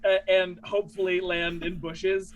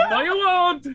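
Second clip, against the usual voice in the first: taunting high comeback; Alexei retort; 12 semitones higher, 5 dB louder than usual. Acted. Alexei taunting Babinski as he escapes. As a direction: fling it back playfully.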